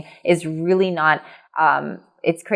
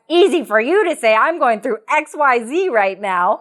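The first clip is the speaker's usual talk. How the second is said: mock objection; 6 semitones higher, 3 dB louder than usual. Voicing a sceptical listener's objection.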